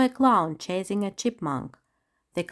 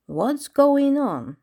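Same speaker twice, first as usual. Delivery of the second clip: slow, puzzled and higher; wondering; 6 semitones higher, 5 dB louder, much slower than usual. A narrator's reading. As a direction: ask in slow bewilderment. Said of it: "the tigress wondered".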